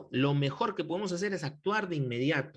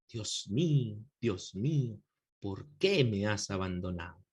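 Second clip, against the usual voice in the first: poetic recitation; 8 semitones lower than usual. Shown as a demonstration. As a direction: lower and hushed, poetic lament. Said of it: "a veces hasta de forma poética". (recitación poética, más grave y suave)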